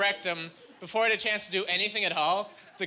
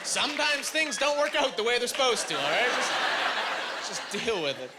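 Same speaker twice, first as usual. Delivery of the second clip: mock lecture; 4 semitones higher than usual. Real, not acted. Comic lectures the heckler, shouting.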